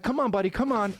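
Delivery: urging the dog out of the athlete's yard, high coaxing call. Retold or imitated. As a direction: plead with the dog to come along. Coaxing dog to come back.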